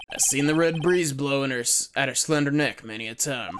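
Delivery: put-on lightly done cowboy Western accent; easygoing Western lawman accent; relaxed Western accent, unhurried; Jake Marshall Western accent. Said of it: "I can do a Western accent decently well".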